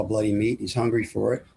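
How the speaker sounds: normal talking voice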